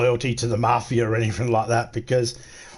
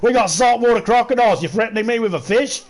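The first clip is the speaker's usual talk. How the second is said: higher, louder, brash Aussie retort; cocky Aussie bloke; 7 semitones higher, 4 dB louder than usual. Retold or imitated. Lightly voiced Australian mocking the mafia's fish threat. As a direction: scoff at the threat like a tough local.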